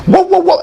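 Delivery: high-pitched repeated falling-stick whoops; imitation falling stick. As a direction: mimic something tumbling through the air. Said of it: "That's my imitation of a stick falling".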